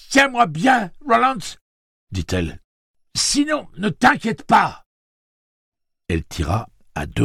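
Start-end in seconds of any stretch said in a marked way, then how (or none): 0.1-1.6 high, loud tense instruction; appel tendu. A narrator's reading. Bracing to shoot, knotted with fear. 3.2-4.8 very high, strained delivery; voix tendue. A narrator's reading. Anxious aside before shooting.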